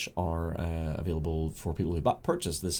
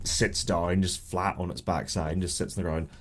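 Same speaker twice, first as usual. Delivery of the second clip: ordinary talk, nothing marked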